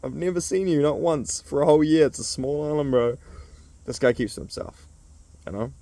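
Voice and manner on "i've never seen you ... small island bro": doubt his island story aloud; mock disbelief. Teasing Jam about the small island, in mock disbelief. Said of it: "It's like".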